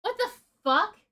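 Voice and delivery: high, loud incredulous outburst; disbelief. Real, not acted. Reading his messages, loud high shocked disbelief.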